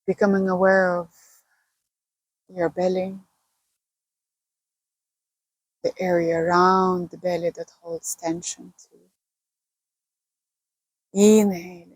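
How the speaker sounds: unhurried meditation guide with long pauses; calm, spacious breathing-and-body-awareness guidance; guided meditation. Acted. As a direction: lead listeners gently into relaxation, pausing between phrases.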